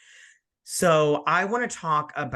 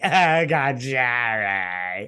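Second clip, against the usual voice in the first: slow, drawn out and louder, with a changed tone; lightly acted quote; 7 dB louder, much slower than usual. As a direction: imitate the client proudly stretching the line. Jerry's client repeats a line.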